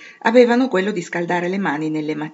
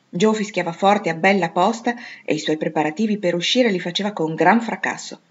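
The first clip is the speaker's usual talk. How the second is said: ordinary delivery, nothing marked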